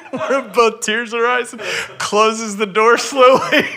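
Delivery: high, breaking into laughter mid-story; laughing while telling. Real, not acted. Speaker recalling the dad catching two tearful strangers, laughing. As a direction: tell it through helpless laughter. Amused telling of an awkward moment.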